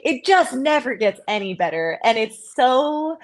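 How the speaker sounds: ordinary delivery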